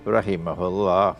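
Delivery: ordinary delivery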